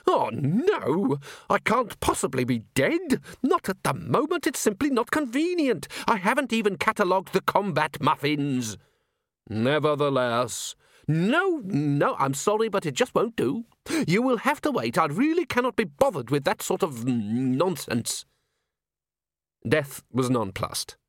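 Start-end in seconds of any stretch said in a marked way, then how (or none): 0.1-8.8 much higher, indignant little man; prim, outraged curator; squeaky fussy curator. Acted. Curator refusing death. 9.5-10.8 very slow, solemn Death; drawn-out, hollow reaper voice; grim reaper voice. Acted. Death replies. 11.0-18.2 much higher, indignant little man; prim, outraged curator; squeaky fussy curator. Acted. Curator refusing.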